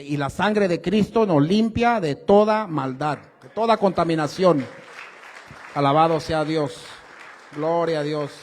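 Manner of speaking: loud, fervent praise from the pulpit; fervent preaching. Acted. A preacher praises God to the congregation, loud and fervent. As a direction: proclaim it with full force.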